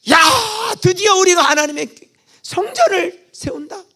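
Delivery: high, loud cry of joy; overjoyed crowd cheering; joyful shout. Retold or imitated. People shouting for joy at temple.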